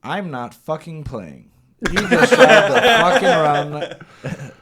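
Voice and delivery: hammed-up furious stylist, going high and loud into laughter; mock angry stylist. Showing the hosts how the stylist yelled, mock angry. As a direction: act out the stylist's threat, cracking up. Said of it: "here's how you do it. Just like this".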